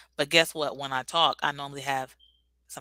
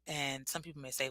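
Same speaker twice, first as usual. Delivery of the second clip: ordinary talk, nothing marked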